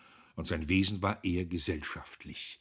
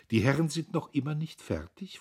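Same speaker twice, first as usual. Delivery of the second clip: high, louder playful question; roguish; 9 semitones higher, 6 dB louder than usual. A narrator's reading. Sister teases two men still at business, roguish. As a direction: tease the gentlemen with mischief. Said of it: "fragte sie schalkhaft".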